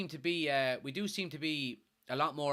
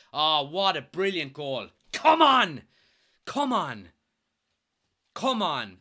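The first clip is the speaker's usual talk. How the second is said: cheering; 7 dB louder, slower than usual. Real, not acted. Fan cheering a brilliant goal, loud and joyful. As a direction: punch the air and yell.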